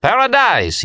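high, held cry of the name; screamed. A narrator's reading. Remy screams his friend's nickname, high exultant cry. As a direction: cry it out very high, falling at the end. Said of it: "he screamed".

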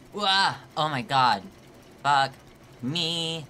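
loud startled yelp, then cursing; alarmed outburst. Real, not acted. Player slipping in a game, loud alarmed cursing. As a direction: yelp and swear at full voice. Game move suddenly goes wrong.

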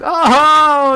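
high loud startled oh; a jolt of alarm; sudden panicked oh at nightfall. Real, not acted.

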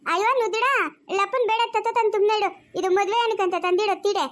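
put-on high-pitched childish lisp; lisping childlike character. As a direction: pipe up high with babyish consonants. (ತೊದಲು ಮಾತಿನ ಮಗುವಿನಂಥ ಧ್ವನಿ)

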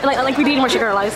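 ordinary talk, nothing marked

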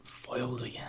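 hushed mock villain line; whispered villain aside. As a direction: breathe it like a foiled villain.

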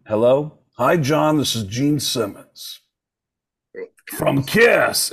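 slow, drawn-out lightly voiced [name]; a mild [name] drawl on the name; [name] impression. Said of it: "this is how we communicate".

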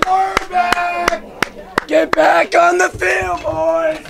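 loud laughing celebration shout. Real, not acted. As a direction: yell to the team. Celebrating, calling to team.

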